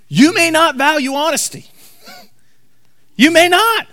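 ordinary delivery

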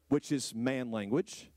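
ordinary talk

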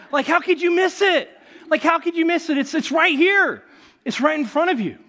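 loud, high disbelief; how could anyone miss it, practically exclaiming; incredulous. Real, not acted. Pastor telling congregation the obvious, loud disbelief.

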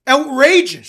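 high, loud outraged shriek; a patient shrieking in outrage at his doctor. A narrator's reading. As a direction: shriek it out, appalled. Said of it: "shrieked the first patient".

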